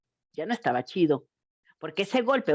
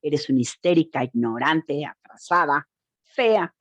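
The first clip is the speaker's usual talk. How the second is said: lightly voiced contempt, lower; a hint of a scornful partner; abuser's insults; 6 semitones lower than usual. Quoting what abusers tell women: low, mild contempt. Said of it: "expresiones como".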